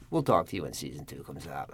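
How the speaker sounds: low, soft mock agent brush-off; dismissive. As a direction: put them off quietly, pitch dropped. Agents brush him off again.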